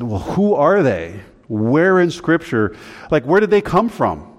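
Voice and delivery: retold puzzled demand for answers; insistent questioning. Acting out their own insistent questioning.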